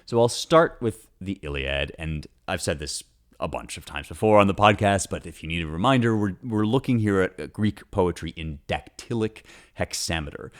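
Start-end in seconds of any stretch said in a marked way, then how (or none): none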